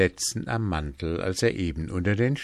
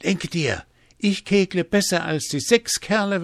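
high, animated boasting; boastful calling out; 9 semitones higher, 5 dB louder than usual. A narrator's reading. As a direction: call your big news across to someone. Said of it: "Hans rief ihm entgegen".

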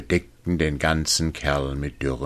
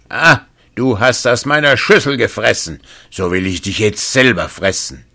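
second giant roaring, higher and louder; giant's shout; 6 semitones higher, 7 dB louder than usual. Acted. The second giant finds the youth and yells in rage, booming shout. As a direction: yell it out like an angry giant. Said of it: "schrie er".

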